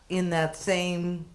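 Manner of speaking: normal talking voice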